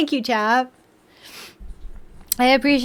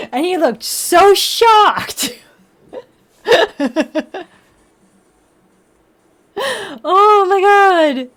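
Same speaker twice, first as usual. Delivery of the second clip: laughing; 6 semitones higher, 6 dB louder, much slower than usual. Real, not acted. Cat's shocked face, laughing.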